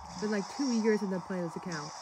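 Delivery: usual speaking voice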